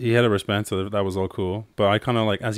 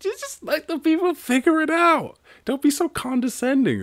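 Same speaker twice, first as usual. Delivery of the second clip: much higher exasperated retort; mock exasperation; 4 dB louder than usual. English hosts answering the Russian, mock exasperated. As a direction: snap back at the minister. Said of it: "And you're like".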